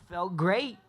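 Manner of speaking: beaming with excitement; excited. Real, not acted. Thrilled after win, crowd.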